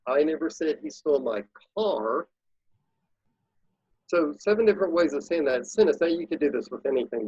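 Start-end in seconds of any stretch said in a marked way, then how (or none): 0.1-2.3 "car" stretched out at the end; stress on CAR. Shown as a demonstration. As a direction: save the weight for the last word, "car". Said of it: "say that sentence quickly seven different ways".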